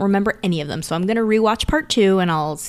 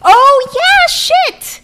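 much higher, louder sudden realization; excited; 9 dB louder, much slower than usual. Real, not acted. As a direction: blurt it out as it clicks.

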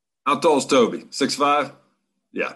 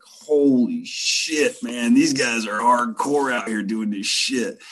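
slow, higher, drawn-out awe; amazed; 5 semitones higher, slower than usual. Retold or imitated. Newcomer reacting to the hardcore crash story, amazed. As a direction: stretch it out in impressed disbelief.

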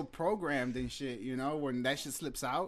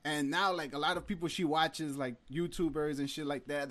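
normal talking voice

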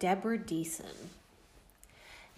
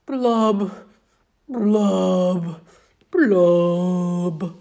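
louder sulky singsong with booming blubs; loud gloomy fish refrain; 8 dB louder, slower than usual. Acted. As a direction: belt the mopey refrain. Refrain again.

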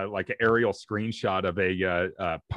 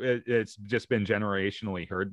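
normal talking voice

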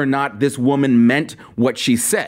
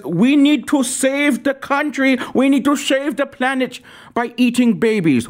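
a hammed-up European accent; European accent impression; 8 semitones higher than usual. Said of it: "she talks like this".

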